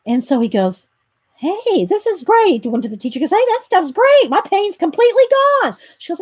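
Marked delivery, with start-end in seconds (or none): 1.4-2.6 high, drawn-out exclamation; mock delight. Comedian retelling his toothache cure, delighted. Retold delight at pain relief. 3.3-5.8 high, loud and thrilled; exuberant retold delight. Patient telling teacher the pill worked, delighted.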